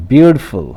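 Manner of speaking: "beautiful" with BEAU stressed, then falling away; first-syllable stress. Shown as a demonstration. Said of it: "put stress on the first syllable". ("beautiful" के पहले syllable पर ज़ोर)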